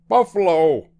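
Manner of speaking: high, loud alarmed shout; shouted warning. A narrator's reading. Driver shouting at sight of the herd, shouted warning. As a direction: cry out like a driver sighting danger. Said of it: "shouted the driver".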